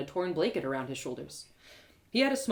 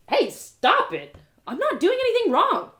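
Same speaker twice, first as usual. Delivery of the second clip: louder, higher outraged protest; indignant protest; 11 semitones higher, 8 dB louder than usual. Acted. Narrator protests as the guard prods her, outraged. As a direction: push back at a rude guard.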